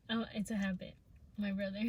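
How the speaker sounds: usual speaking voice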